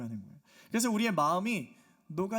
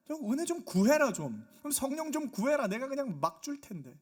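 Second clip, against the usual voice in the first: raised, higher voice of pleading God; exasperated urging; 6 semitones higher than usual. Acted. God urging people to just ask, exasperated urging. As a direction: urge them as if exasperated.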